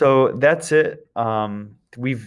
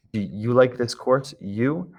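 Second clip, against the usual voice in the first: ordinary talk, nothing marked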